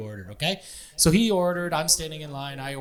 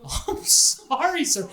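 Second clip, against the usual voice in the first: high-pitched, drawn-out sorry; slow, much higher retold apology; imitated apologetic clerk; 11 semitones higher, 4 dB louder, much slower than usual. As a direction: play the berated counter girl apologising. Clerk apologising to berating customer, retold.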